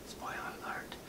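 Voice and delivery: whispered spoiler alert. Acted. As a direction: breathe it as a secret. Secret aside to viewers.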